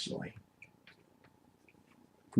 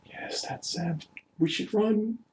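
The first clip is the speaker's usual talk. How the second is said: high, hushed timid urging; timid, worried; 9 semitones higher, 7 dB quieter, much slower than usual. Acted. Companion urging the party to flee a thug, timid. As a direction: urge escape in a small, high, soft voice. Frightened companion urges escape from villain.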